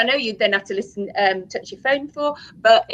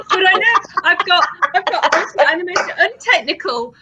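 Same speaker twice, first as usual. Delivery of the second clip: laughing; 8 semitones higher, 3 dB louder than usual. Real, not acted. Laughing about own technology skills.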